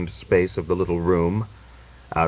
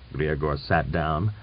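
ordinary delivery, nothing marked

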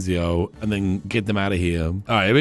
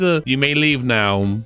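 ordinary talk, nothing marked

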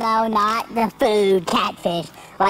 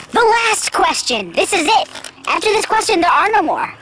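high, raised-voice mock-grand announcement; emphatic announcement; 8 semitones higher, 4 dB louder than usual. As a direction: proclaim it with exaggerated finality. Stalling, hammers home that it's final.

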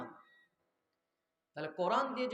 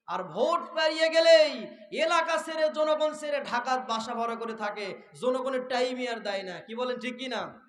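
raised voice, bursting out loud then easing; forceful preaching; 4 semitones higher, 7 dB louder than usual. Real, not acted. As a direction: rail at absent leaders before the crowd. Preaches at the crowd. (জোরালো ওয়াজের ভঙ্গি)

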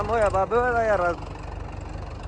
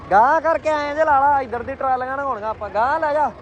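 calling out; 7 semitones higher than usual. Real, not acted. Calling out directions.

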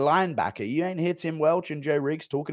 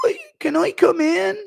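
high, loud, whiny British plea; Ian Gary impression; 6 dB louder than usual. Ian Gary begging entry to a party, high whiny plea. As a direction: plead high and loud at the door. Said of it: "That's the Ian Gary one".

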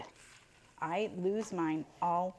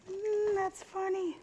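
amused; slower than usual. Real, not acted. Recovering from laughing at joke.

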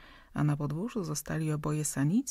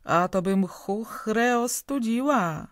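loud, slow, flushed threat; loud, slow and furious; angry threat; 5 semitones higher, 6 dB louder, slower than usual. A narrator's reading. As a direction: Tomaszowa blushing with rage, loud threat. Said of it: "krzyknęła Tomaszowa, czerwieniejąc".

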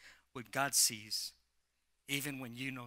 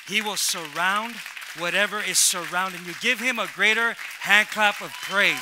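loud, rousing preacher; rally the congregation at full volume; booming call to praise; preaching at full force; 4 semitones higher, 13 dB louder than usual. Real, not acted.

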